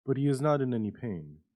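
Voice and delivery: ordinary delivery, nothing marked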